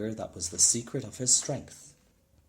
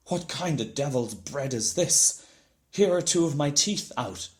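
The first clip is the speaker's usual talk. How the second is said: louder, outraged roar; angry shout; 3 semitones higher, 7 dB louder than usual. A narrator's reading. Giant shouting about the hard bread, furious. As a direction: bellow out in pain and fury. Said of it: "shouted Cuchulainn".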